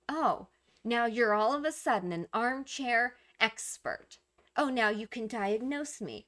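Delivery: snide, mocking put-down with exaggerated stresses; snotty. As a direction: sneer at the would-be expert. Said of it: "they get very snotty like that".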